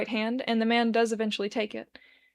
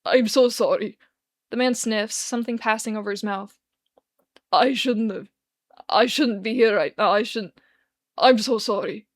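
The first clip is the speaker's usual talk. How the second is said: louder, tearful slurred apology; drunk crying apology; 5 dB louder than usual. Acted. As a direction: sniffle through each sorry. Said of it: "wait, is he crying?".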